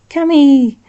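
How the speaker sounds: loud, high happy call; aunt calling to her nephew across the yard; calling out delighted. A narrator's reading.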